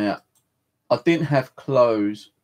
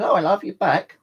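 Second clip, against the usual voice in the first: lightly voiced cashier, higher and louder; imitating the cashier; 8 semitones higher, 5 dB louder than usual. Cashier to returning customer, lightly imitated in a raised voice. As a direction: pitch it up as the cashier greeting a customer.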